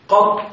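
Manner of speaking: "قط" cut to one syllable with a single t; light ta with sukun. Shown as a demonstration. Teacher showing students the short variant, "قط" pronounced qat. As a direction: say "قط" as qat, no final vowel. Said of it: "بتخفيف الطاء مع السكون".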